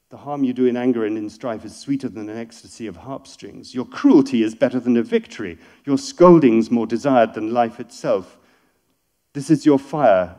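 loud, measured declamation; poetry reading. Acted. Rereads the verse aloud.

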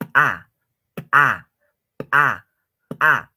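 short, loud popping "pa"; ejective P. Shown as a demonstration. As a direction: make the P of "pa" burst. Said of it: "reventar o explotar algo". (pa eyectiva, como reventando)

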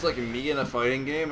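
ordinary talk, nothing marked

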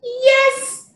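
Patty said high and loud; a bright, excited reveal of Patty. Shown as a demonstration. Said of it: "there's another one".

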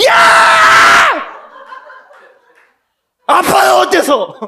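high, loud mock shout at the teens. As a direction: bellow it in fake outrage. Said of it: "그럼 제가 소리 빼게". (아이들에게 장난스럽게 버럭 소리치는 고함)

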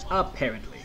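Apparently in a put-on different voice; mock voice. Shown as a demonstration. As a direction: drop into a funny other voice on Apparently. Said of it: "you already know what that is apparently".

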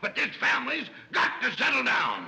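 blustering, fed-up outburst; exasperated bellow. Acted. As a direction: bellow over the whole squabbling family. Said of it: "Will you stop bellowing?".

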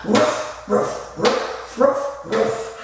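short rhythmic barks; playful dog imitation for children; dog woofs. Shown as a demonstration. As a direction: woof like a doggy on the beat. Answering chant with dog sounds.

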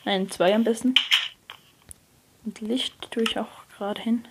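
normal talking voice

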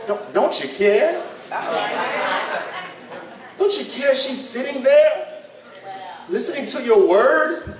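mock Martha. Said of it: "she had the nerve to ask".

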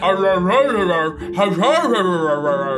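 mock wordless arring, rising and falling; imitated garbled creature noise. Player mocking what a companion said, garbled arr noises. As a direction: mimic someone's speech as doglike noises. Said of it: "You went".

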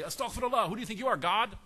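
overplayed pious horror; mock scandalized outrage. Father wrongly scolding Yusuf's dream, mock outrage. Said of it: "he didn't say".

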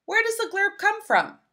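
usual speaking voice

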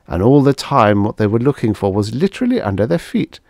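ordinary delivery, nothing marked

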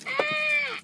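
high sharp startled squeak; startled squeal. Real, not acted. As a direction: yelp as things drop. Dropping charms in car.

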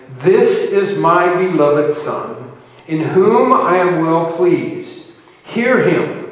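loud, slow, solemn proclamation; voice from the cloud. Acted. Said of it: "a voice came out of the cloud saying".

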